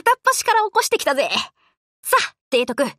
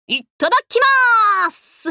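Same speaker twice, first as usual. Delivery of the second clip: 1 eager; 9 semitones higher, 4 dB louder than usual. Acted. Digging into breakfast.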